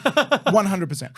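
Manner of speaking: real laugh breaking through yeah; laughing yes to the co-host's joke; laughing agreement. Real, not acted.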